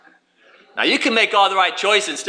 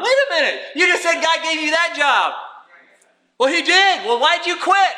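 higher, incredulous challenge; mock disbelief; 8 semitones higher than usual. Preacher challenging a man who quit, incredulous. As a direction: catch him out in disbelief.

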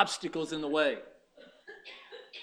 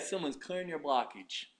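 normal talking voice

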